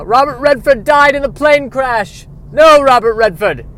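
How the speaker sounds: high, loud dramatic lament; mock melodrama. Retelling Out of Africa's sad plot, mock melodrama. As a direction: belt out the tragic plot.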